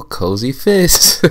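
slow, stretched announcement of the name; drawn-out name. Acted. Host slowly unveils the outfit name, drawn out. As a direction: savor each word like a runway call.

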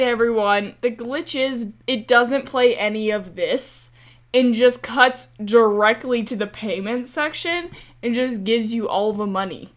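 ordinary talk, nothing marked

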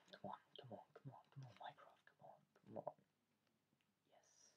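hushed whisper, urging the game on; breathless whispered coaxing. Real, not acted. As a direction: whisper it like a secret plea. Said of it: "Why am I speaking like this?".

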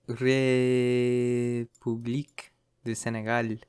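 a slow, careful French République; French accent. Shown as a demonstration. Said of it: "that's my French accent".

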